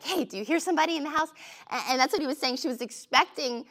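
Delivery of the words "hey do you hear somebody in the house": high, fast anxious whisper-less nudge; a nervous wife poking her husband awake, high and quick. Retold or imitated. Wife waking husband at night, high and fast. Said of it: "you've ever been like".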